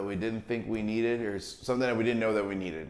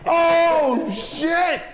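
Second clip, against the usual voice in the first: laughing shout; 8 dB louder than usual. Real, not acted. Exclaims while laughing.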